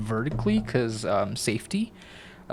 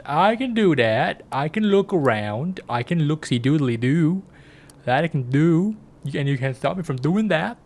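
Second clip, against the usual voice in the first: higher, bouncy swooping tune; playful sing-song; 4 semitones higher than usual. Pretend. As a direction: lilt it up and down, showing off. Playful sing-song reply to game.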